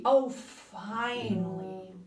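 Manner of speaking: finally arrived, relieved; exclaiming. A narrator's reading. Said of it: "exclaimed Koala".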